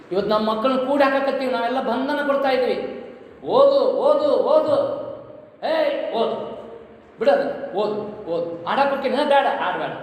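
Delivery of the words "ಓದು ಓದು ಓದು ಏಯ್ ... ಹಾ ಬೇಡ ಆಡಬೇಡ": hammed-up nagging, repeated sharp commands; nagging parent. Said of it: "ಅಂತ ಹೇಳ್ತೀವಿ".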